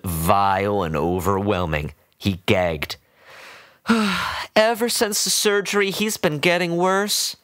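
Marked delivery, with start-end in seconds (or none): 3.9-4.5 high sigh. A narrator's reading. Woman sighs before speaking. 4.6-7.4 put-on doting old woman. A narrator's reading.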